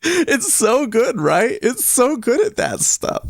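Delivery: high-pitched, amused gushing; delighted. Real, not acted. Streamer tickled by the silly computer voice, high and delighted.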